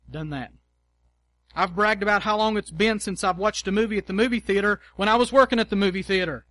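normal talking voice

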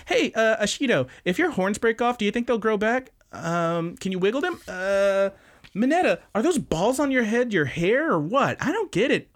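high, cutesy airhead voice; distracted, curious girl. Hado jumps in front of Shoji, high curious chirp. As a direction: imitate a girl firing off curious questions. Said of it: "she's just geeking out".

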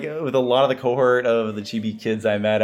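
ordinary talk, nothing marked

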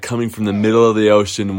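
usual speaking voice

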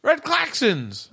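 high yelled Red klaxons; yelling alarm. Shown as a demonstration. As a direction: blare Red klaxons like a siren. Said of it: "yelling klaxon very loud".

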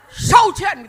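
imitating a seller, high and loud; loud vendor retort. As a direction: fire the price back loudly. Acting the seller's loud answer.

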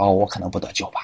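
a believer shrinking after a scare; timid worry. Retold or imitated. Voices a frightened believer.

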